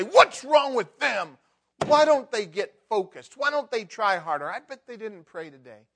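loud high self-righteous scolding; mocking a self-righteous churchgoer. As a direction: look down your nose at them, loudly. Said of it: "self-righteous high horse and say".

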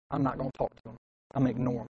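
low, soft sulky vow; hushed acted grudge; quiet resentment. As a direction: withdraw coldly, as if to yourself. Acted child's silent resentment.

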